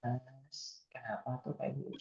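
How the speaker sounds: very soft, gentle coaxing. Retold or imitated. Wife soothing her angry husband in a very soft coaxing voice. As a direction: coax her husband softly, almost under the breath. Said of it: "saya tuh pengen dibujuknya kayak".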